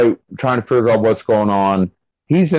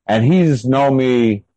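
ordinary talk

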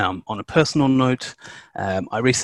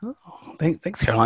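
normal talking voice

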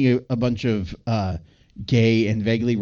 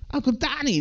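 high, softened echo of a yell; mock yell; 13 semitones higher than usual. Co-host echoing the yelling guy, restrained high mock yell. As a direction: mimic a holler without real volume. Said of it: "some guy yells".

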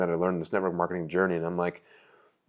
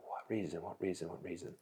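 much softer with each repeat, almost gone; fading despairing question; 16 dB quieter than usual. Retold or imitated. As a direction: let each "What reason?" die away.

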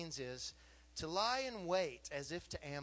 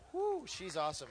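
admiring whew; 3 semitones higher, 6 dB louder than usual. Real, not acted. Praising his wife.

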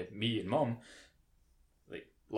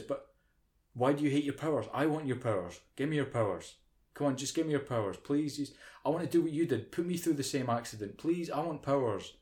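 higher, whiny begging voice; whiny pleading Trish; 5 semitones higher than usual. Retold or imitated. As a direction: beg for the powers like a pushy friend. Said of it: "she just kinda says".

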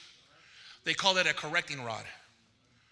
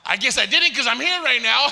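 loud, higher, breaking into laughter; laughing; 5 semitones higher, 11 dB louder than usual. Real, not acted. Preacher amused at his own story, laughing loudly. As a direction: boom it out through a laugh.

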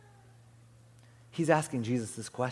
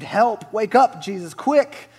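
loud, high cry for help; storybook panic, acted out; screamed plea; 8 semitones higher, 11 dB louder, slower than usual. A narrator's reading. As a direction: shout urgently, climbing higher. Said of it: "They screamed".

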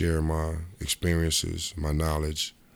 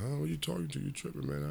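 higher, softer, disbelieving; baffled; 10 semitones higher, 9 dB quieter than usual. Retold or imitated. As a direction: ask in stunned surprise. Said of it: "So I'm like".

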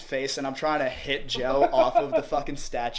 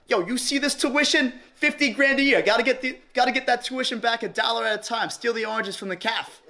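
put-on cocky New York accent, pitched higher; 8 semitones higher than usual. Joking to campus cops in a hammed-up New York accent. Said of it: "they hear the New York accent".

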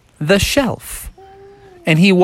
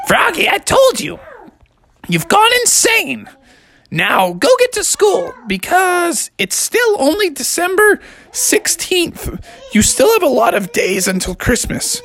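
mock-shrieking mother telling him off; hammed-up fed-up mom yelling; exasperated mom. Mom scolding Froggy.

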